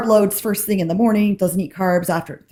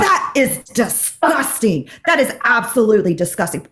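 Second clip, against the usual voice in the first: dramatic disgust. Retold or imitated. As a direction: imitate an overdramatic diner rejecting food. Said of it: "it was so dramatic".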